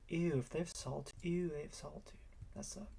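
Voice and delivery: high, soft ew of disgust; grossed-out muttering. Real, not acted. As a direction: wrinkle your nose at it. Disgust at opponent's cards.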